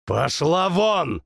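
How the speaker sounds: slow, mildly growled order. Acted. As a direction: growl her away. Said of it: "рыкнул я".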